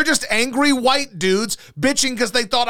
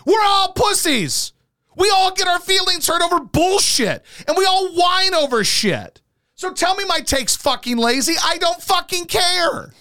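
ranting; 6 semitones higher than usual. Real, not acted. Host fires back at a lazy-take accusation, ranting. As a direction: holler the rant with stretched stressed words. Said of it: "this house is not soundproof".

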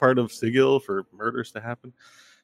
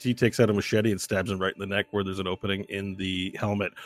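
ordinary talk, nothing marked

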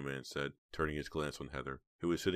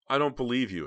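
high, louder defiance; hiding fear; 9 semitones higher, 8 dB louder than usual. A narrator's reading. Heather doubts the blackmailer, defiant over hidden fright. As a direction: challenge him, covering your fear. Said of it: "trying not to let him see how frightened".

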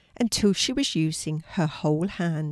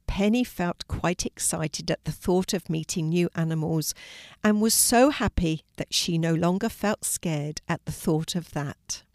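ordinary delivery, nothing marked

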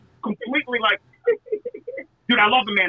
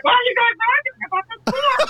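much higher mock-innocent voice, copying her; mocking Denise; 13 semitones higher than usual. [name] mocking Denise's question about the form, high and innocent. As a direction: squeak it out like a clueless outsider. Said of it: "She's like".

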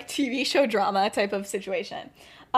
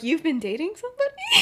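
higher-pitched mock disbelief; incredulous question; 5 semitones higher than usual. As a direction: act out a startled discovery. Lily acted learning of a girlfriend.

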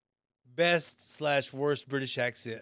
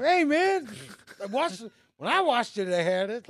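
pleading pitched up; defensive protest; 10 semitones higher than usual. Retold or imitated. Defending himself to classmates, high pleading protest. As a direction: plead your case to the angry class. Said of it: "everybody looked at me and go".

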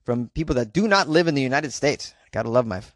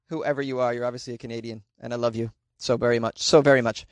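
usual speaking voice

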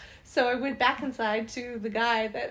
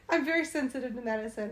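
normal talking voice

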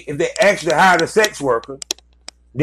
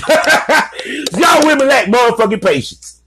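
laughing; 7 semitones higher, 3 dB louder, slower than usual. Real, not acted. Teasing jab at women.

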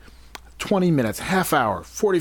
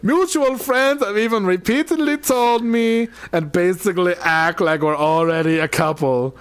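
higher put-on African accent; African security guard voice; 4 semitones higher than usual. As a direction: play a self-important African guard. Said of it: "my African security guard voice".

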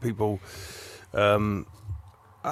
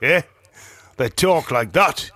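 slow, hammed-up Geordie; Geordie accent; slower than usual. Shown as a demonstration. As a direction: stretch the vowels into broad Geordie. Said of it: "your Geordie accent was terrible".

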